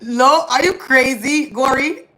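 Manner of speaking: high, loud, drawn-out disbelief; indignant, voice climbing on the question; incredulous protest. Real, not acted. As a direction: push back in loud, stretched-out disbelief. Indignant reply to viewer's no-tears claim.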